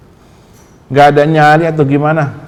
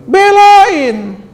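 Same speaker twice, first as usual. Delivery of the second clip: urgent call; 6 dB louder than usual. Acted. Preacher urging listeners to defend.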